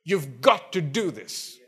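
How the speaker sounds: slow, pressing GOT; stress on GOT. Shown as a demonstration. Preacher explaining the pressure behind should, stress on GOT. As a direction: lean on GOT as an obligation. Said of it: "there's pressure".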